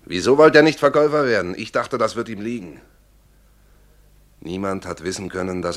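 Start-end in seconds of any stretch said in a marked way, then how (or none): 0.0-1.5 loud, higher protest; indignant self-defence. Acted. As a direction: protest to the court as you justify yourself. Witness defending himself in court. (empört rechtfertigend)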